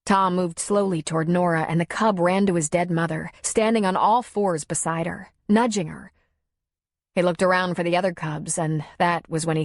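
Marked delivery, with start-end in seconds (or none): none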